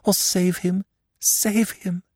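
high, imploring repeated pleas, fading at the end; desperate pleading. Acted. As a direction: beg again and again.

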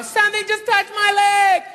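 high-pitched frightened girl, hammed up; girl in preview. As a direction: squeal it as a mock panicked girlfriend. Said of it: "you hear the girl go".

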